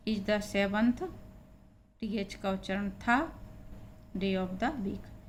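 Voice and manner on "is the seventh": usual speaking voice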